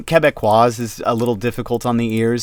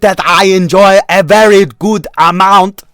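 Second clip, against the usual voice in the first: 1 hammed-up silly voice; 9 semitones higher, 12 dB louder than usual. Joking put-on voice.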